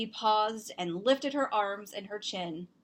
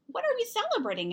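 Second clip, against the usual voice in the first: much higher and quick, puzzled; curious brother; 13 semitones higher, 3 dB louder, faster than usual. Acted. Brother asks mom about celebrating, high and fast. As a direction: rush the question up high. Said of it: "asked Zoe's brother".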